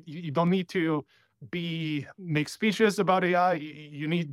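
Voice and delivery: usual speaking voice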